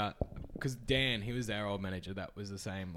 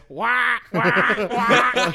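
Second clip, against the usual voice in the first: loud high honking wahs with a laugh; mock honking wah; 8 semitones higher, 7 dB louder, much slower than usual. Retelling the ibis man honking in his face, loud mock honk. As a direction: honk it out like a bird head. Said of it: "he just goes".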